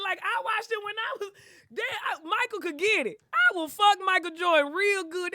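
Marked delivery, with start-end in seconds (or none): 3.3-5.3 excited. Real, not acted. Gushing over Jordan, loud.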